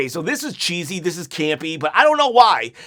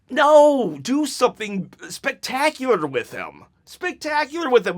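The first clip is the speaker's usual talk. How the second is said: ordinary delivery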